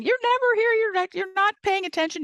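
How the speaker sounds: mimicking a partner's exasperated accusation; mock accusing complaint. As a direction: nag the partner in a shrill, high mock complaint. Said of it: "instead of going".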